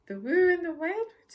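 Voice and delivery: high, loud startled splutter. Acted. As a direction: blurt it out in alarm. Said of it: "he spluttered".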